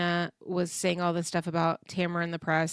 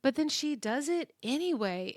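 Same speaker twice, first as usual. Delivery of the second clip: ordinary talk, nothing marked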